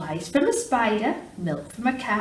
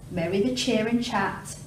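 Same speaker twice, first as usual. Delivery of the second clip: ordinary delivery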